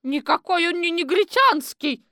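mock the strange exhibit; sporty citizen woman. Четверухина спорит со сторожем. (насмешливо, высоким громким голосом)

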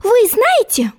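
put-on high, indignant girlish question; young girl voice. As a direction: challenge like an offended girl. Alice challenging.

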